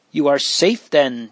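usual speaking voice

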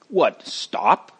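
indignant, incredulous retort with rising end; angry retort. A narrator's reading. Crowd answering those who defended the prisoners, angry. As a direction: throw it back as an irritated question. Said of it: "others angrily yelled back".

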